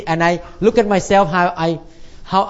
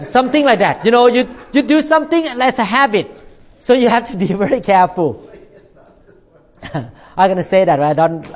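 normal talking voice

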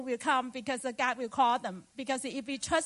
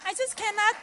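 excited; 6 semitones higher, 6 dB louder, faster than usual. Real, not acted. Excited testimony about telling people.

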